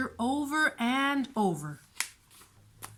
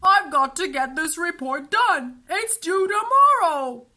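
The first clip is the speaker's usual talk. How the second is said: pleading; 6 semitones higher, 8 dB louder than usual. A narrator's reading. Henry pleading with sister.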